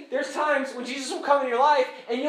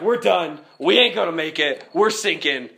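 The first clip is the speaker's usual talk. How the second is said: mock despairing; 6 semitones lower, 4 dB louder than usual. Acts disciples at end of rope.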